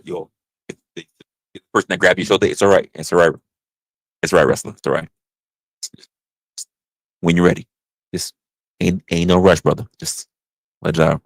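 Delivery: low, soothing group-member voice; calm him down gently, from deep down; low reassuring comfort. Acted. Fellow member soothing the crying wrestler, low and gentle.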